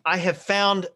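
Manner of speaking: normal talking voice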